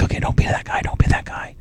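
whispering to himself; whispered self pep talk. Retold or imitated. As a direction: hush it out, calming yourself. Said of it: "I'm like".

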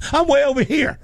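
high-pitched mock holler; mock calling out. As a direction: pretend to call over to distant rescuers. Acts calling to rescuers going past.

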